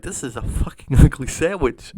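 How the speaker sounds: loud mock-outraged customer voice; outraged complaint. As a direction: burst out loudly, imitating the disgusted customer. Customer outraged at the new sandwich.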